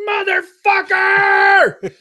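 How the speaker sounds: huge high yell of frustration; drawn-out high furious curse; furious shout. Retold or imitated. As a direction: scream it as the glass shatters. Glass breaks again.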